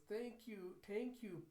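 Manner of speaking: lightly voiced child, pitched high; grateful little girl. Child thanks papa's boss, lightly voiced gratitude.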